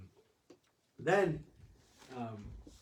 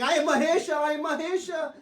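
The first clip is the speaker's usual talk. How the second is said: much higher, louder, grandly playful shout; hammed-up divine boast; mock god proclamation; 12 semitones higher, 7 dB louder than usual. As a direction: proclaim yourself Shiva while riding an ox, half-laughing. Riding the ox as Shiva.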